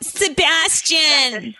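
put-on slow high Valley girl drawl. Shown as a demonstration.

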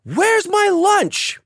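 high, loud, slow furious shout; angry shout. Retold or imitated. Casting director storming in, shouting about her lunch. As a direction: yell through the door at a coworker. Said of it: "She goes".

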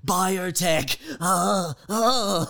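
high, fretful whimper; anxious whimpering genius. Pretend. Nervous genius whimpering when asked about biotech. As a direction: whimper in panic at the question.

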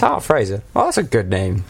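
put-on half-hearted Irish accent; a hint of an Irish granddad; attempted Irish accent. Grandson quoting granddad on his name, tentative Irish accent. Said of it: "I was gonna do an Irish accent".

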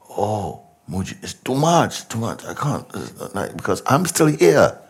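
high, pleading cry of a seeker; overwhelmed seeker. Retold or imitated. Teacher voices a frightened seeker begging Mooji, high and pleading. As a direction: plead like a student swamped by it all. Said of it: "who is asking, "What am I to do?".

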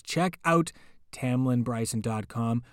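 plain reading voice

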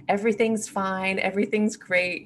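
bright put-on "all is fine" tone; pretending life is great through your teeth; fake cheerfulness. To the world, hiding money troubles: forced cheerful tone. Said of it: "we're pretending through our teeth".

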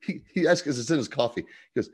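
ordinary delivery

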